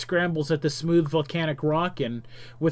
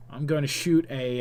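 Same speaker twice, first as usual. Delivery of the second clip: ordinary talk, nothing marked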